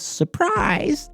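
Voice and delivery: imitating a shrill surprise shout; mock surprise. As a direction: squeal it like a party reveal. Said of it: "she goes".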